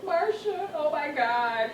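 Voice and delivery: high tearful opening, easing off; retold crying. Mother calling her girlfriend from the bathroom, mock-tearful plea. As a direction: lightly act out a crying phone call. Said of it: "I'm crying and crying".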